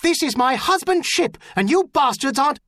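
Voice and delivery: high, furious defiance; loud, outraged protest; angry shout. A narrator's reading. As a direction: shout down the boarders, breaking off abruptly. Mother defying boarders, cut off.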